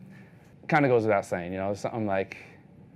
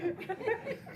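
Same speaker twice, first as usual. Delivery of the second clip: high giggle after the bit; tickled high laugh; high squeaky laugh; 9 dB quieter than usual. Real, not acted. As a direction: laugh at your own joke, way up high. Laughs after her own parody.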